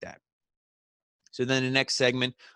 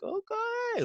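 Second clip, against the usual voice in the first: drawn-out, very high mock okay; high sing-song mimicry; 4 dB quieter than usual. Wrestler pocketing another man's phone, high sing-song mimicry. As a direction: imitate a sly reaction, voice shooting way up.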